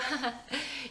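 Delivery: put-on high drunken giggle; tipsy wife giggling at her husband. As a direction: giggle at her own joke. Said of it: "Vicky is tipsy now".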